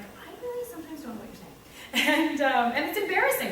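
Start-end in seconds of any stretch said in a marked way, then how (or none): 0.0-1.5 small, high, quick voice; timid confession. Retold or imitated. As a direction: squeak it softly, as if admitting a secret. Confessing confusion to fellow teachers.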